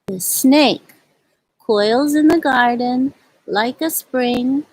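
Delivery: gentle, unhurried read-aloud poem voice; slow, tender picture-book reading; reading to children. Acted. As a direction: read it like storytime for little kids. Reads picture-book poems to children.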